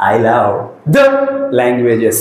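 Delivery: I love the drawn out very slowly, rising on the; slow demo phrase. Shown as a demonstration. Teacher says a phrase slowly for the class to repeat. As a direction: stretch I love the slowly, then drop to languages. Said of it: "నేను ఒక మాట చిన్నగా చెప్తాను".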